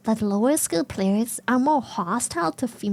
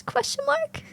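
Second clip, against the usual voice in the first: high squeaky mock question; 13 semitones higher than usual.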